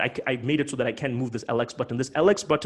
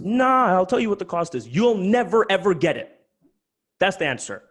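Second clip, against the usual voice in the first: louder, emphatic refusal; animated; 6 semitones higher, 5 dB louder than usual. Real, not acted. Host refusing late buyers, loud and emphatic. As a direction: slam the door on it. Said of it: "I'm just speaking animated".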